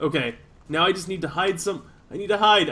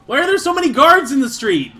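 high-pitched frustrated complaint; loud, high, fed-up ranting; exasperated outburst; 9 semitones higher, 5 dB louder than usual. Real, not acted. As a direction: rail at the game, voice shooting up. Frustrated at game guards.

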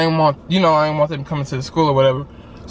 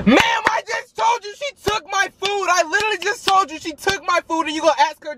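exasperated shout; 13 semitones higher than usual. Retold or imitated. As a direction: shout it like nobody's listening to you. Retold outburst at the teacher.

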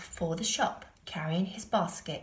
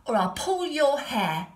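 higher, loud threatening monkey; cheeky monkey threat; 5 semitones higher, 6 dB louder than usual. A narrator's reading. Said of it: "said the monkey".

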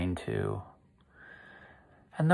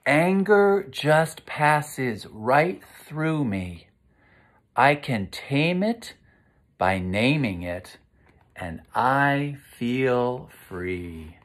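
slow, sing-song recital of a calming rhyme; recited refrain; 3 semitones higher, much slower than usual. Acted. Teacher reciting the calming rhyme to children, slow and sing-song. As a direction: chant it gently like a mantra.

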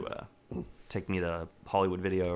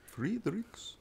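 falsetto echo; 13 semitones higher, 5 dB quieter than usual. Pretend.

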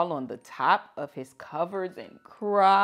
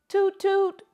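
much higher, louder toot toot; train horn toot; 14 semitones higher, 7 dB louder than usual. A narrator's reading. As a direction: sing out the horn like a train whistle.

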